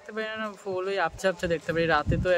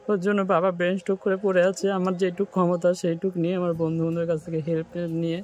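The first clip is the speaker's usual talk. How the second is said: ordinary delivery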